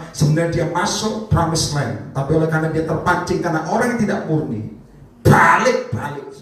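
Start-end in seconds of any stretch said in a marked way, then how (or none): 5.2-6.3 slow and loud; slow weighty repetition; drawn-out emphasis. Acted. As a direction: lean on it heavily. Dramatic preacher emphasis.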